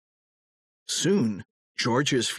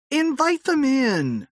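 high, louder bright welcome; cheerful invitation; 13 semitones higher, 4 dB louder than usual. A narrator's reading. The wife inviting her husband's young fans inside, cheerfully. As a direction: sing it out to the guests.

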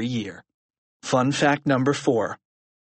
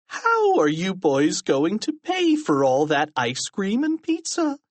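slow questioning, shooting up high at the end; suspicious principal; 6 semitones higher than usual. A narrator's reading. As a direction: draw out the question to a high finish. Krupp questions the boys.

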